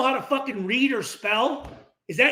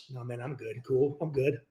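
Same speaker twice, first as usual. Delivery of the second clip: much softer, lower mumbled no-thanks; meek brush-off; 9 semitones lower, 9 dB quieter than usual. Retold or imitated. Teammate declining to confront the coach, quietly brushing it off. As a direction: shrug it off quietly to avoid conflict.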